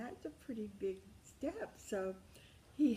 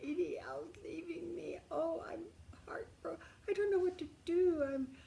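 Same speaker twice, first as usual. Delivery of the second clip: much higher, mild mock crying plea; replaying her heartbroken call, pitched way up; retold sobbing; 10 semitones higher, 6 dB louder than usual. Telling her best friend Dee Dee her husband is leaving, in high retold distress. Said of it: "I'm sobbing and sobbing".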